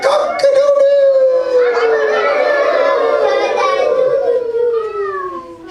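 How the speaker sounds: very high, long, loud rooster crow. Shown as a demonstration. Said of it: "like a rooster".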